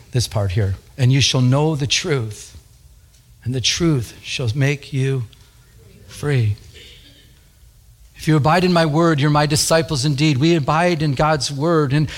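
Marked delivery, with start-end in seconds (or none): 1.0-6.6 slow, lowered reading with weighty pauses; scripture reading. Acted. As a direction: let each phrase of the verse settle. Reads scripture aloud.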